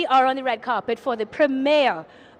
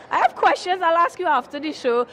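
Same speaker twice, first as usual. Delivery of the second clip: ordinary talk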